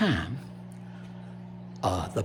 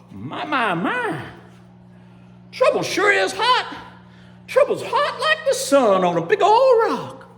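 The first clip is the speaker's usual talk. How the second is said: high, loud, exclaiming character voice; excited gator king; 5 dB louder than usual. Acted. Gator king feeling the fire's heat, high loud exclamation. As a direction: climb high and belt out the complaint. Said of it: "he said".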